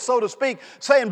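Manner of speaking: ordinary delivery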